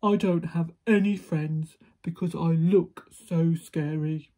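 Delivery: big loud giant, woeful; very sad giant. A narrator's reading. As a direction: complain mournfully in the giant's voice. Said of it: "said the giant very sadly".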